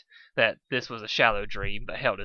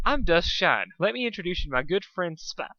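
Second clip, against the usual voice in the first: false enthusiasm; 7 semitones higher than usual. Acted. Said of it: "with a false enthusias".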